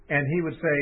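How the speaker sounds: usual speaking voice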